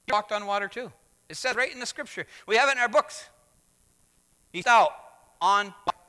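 loud, high-pitched emphatic preaching; preaching at full force. Acted. Pastor proclaiming to the congregation that Peter walked on water, full-force preaching. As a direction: drive the point home to the congregation.

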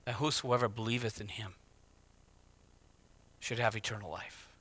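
hushed correct scripture; soft true verse. Shown as a demonstration. Preacher softly corrects the misquote for the congregation, hushed. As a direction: quietly read whosoever believeth in him. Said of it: "that whosoever does all the right things".

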